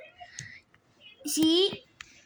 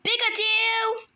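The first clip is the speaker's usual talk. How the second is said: higher, shouted call; 7 semitones higher than usual. Pretend. Kid yells for Pikachu to come here, calling out. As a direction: yell the name across the room.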